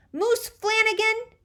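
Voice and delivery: high, slow, loud cry of a name; child shrieking a name; calling out. A narrator's reading. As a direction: yell his name like a bossy little sister. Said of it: "Teresa cries".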